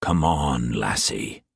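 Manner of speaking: coaxing a terrified Mei Mei gently; gentle coaxing. A narrator's reading. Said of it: "he said gently".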